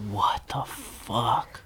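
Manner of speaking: slow, hushed, breath-only shock; stunned disbelief. Real, not acted. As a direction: whisper it slowly, word by word. Listener stunned by the story.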